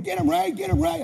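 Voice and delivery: high, loud cheering on; yelling encouragement. Retold or imitated. Dad yelling at his son to win the fight, high and loud. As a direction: egg him on from the side. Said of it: "I'm hearing him yell".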